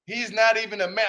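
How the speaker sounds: usual speaking voice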